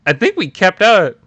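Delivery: ordinary delivery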